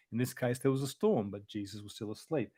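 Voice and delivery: ordinary delivery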